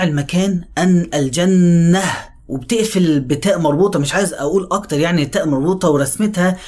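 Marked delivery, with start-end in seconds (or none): 0.8-2.0 الجنة with a wide held nasal on the doubled ن; spacious ghunna. Shown as a demonstration. Teacher giving a sense of space in الجنة. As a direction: open up the ن in الجنة slowly. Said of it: "الغنة بتاعتها تديك انطباع باتساع".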